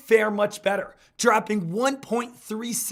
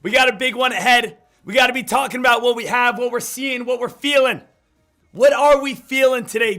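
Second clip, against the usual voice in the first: hype host; 4 semitones higher, 6 dB louder than usual. Acted. Pumped-up morning show opener.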